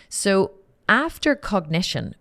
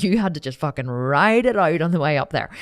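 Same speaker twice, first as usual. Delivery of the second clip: ordinary delivery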